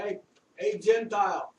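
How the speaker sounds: Staff Sergeant Donato Santaserra. Retold or imitated. A Brooklyn sergeant comes up to a soldier, calling him out loudly. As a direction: call out like a New York staff sergeant. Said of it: "Staff Sergeant Donato Santaserra in Brooklyn, New York".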